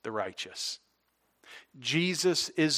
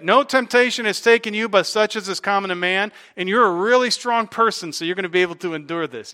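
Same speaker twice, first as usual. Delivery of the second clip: higher and louder, pumping it up like false comfort; mock misquote; 5 semitones higher, 5 dB louder than usual. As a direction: sell the wrong version with mock bravado. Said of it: "Paul does not say".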